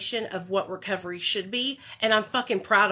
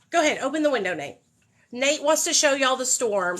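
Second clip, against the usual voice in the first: ordinary talk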